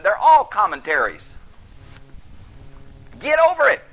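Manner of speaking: loud, high, fervent preaching; full-force preaching. Real, not acted. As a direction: drive it home from the pulpit.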